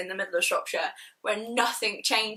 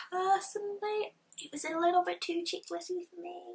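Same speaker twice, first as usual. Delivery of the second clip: much higher and much softer, a confiding aside; hushed aside; 8 semitones higher, 7 dB quieter than usual. Real, not acted. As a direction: lean in and confess it softly. Confiding negative opinion.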